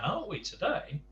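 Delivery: ordinary delivery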